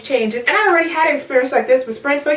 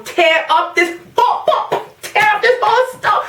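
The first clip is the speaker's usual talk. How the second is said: mock rampage; 9 semitones higher, slower than usual. Venting threat to the store.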